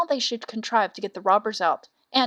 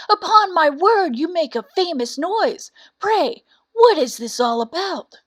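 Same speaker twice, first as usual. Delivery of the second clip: hearty donkey, higher and louder; cheery donkey voice; 6 semitones higher, 5 dB louder than usual. Acted. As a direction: greet him with loud good cheer. Ass hails the cock.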